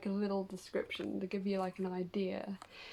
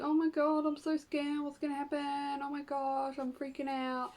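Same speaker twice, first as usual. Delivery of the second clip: mock panic; 4 semitones higher than usual. Mocking someone afraid of the unknown, a higher mock panic. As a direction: play the scared, panicking newcomer. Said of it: "not to be all like".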